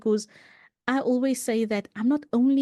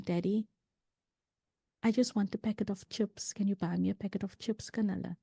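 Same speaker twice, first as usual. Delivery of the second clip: soft, small voice asking daddy; quiet humble child asking his father for chips; humble request; 8 dB quieter than usual. Retold or imitated. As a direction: plead gently, almost under the breath. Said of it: "in a very humbling tone".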